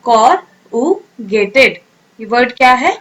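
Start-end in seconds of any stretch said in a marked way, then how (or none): 0.0-1.8 corrugated stretched very slowly, each syllable clear; slow, careful pronunciation model; drawn-out model word. Shown as a demonstration. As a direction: hold out corrugated for the class to copy. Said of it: "ये word को आप फिर से pronounce करें".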